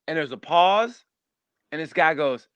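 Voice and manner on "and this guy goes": usual speaking voice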